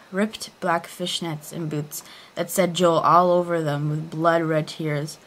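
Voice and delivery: ordinary delivery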